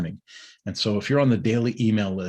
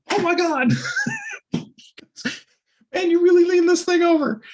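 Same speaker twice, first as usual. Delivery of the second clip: squeaky, much higher and louder astonishment; stunned kid; 5 dB louder than usual. Retold or imitated. Young rider squealing in shock at the worn tire edge. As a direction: squeal it with a laugh in it.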